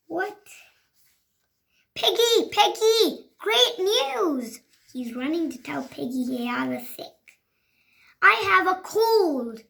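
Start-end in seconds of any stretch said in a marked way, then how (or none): 1.9-4.6 excited call. Acted. Excited calling. 8.2-9.6 happy announcement. Acted.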